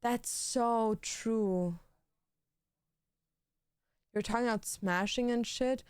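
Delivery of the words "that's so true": slow drawn out. Acted.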